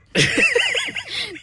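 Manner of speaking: sudden squeaky burst of laughter; high laugh. Real, not acted. Actor cracking up mid-scene, high laugh. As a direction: break into a high giggle. Breaks from her role.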